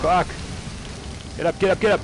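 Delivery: high urgent yelling; panicked shout. Real, not acted. Character knocked down.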